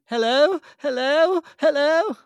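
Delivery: high, loud rising hello; film catchphrase impression. Host mimicking the favourite film line, high and loud. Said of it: "we mentioned my top one earlier".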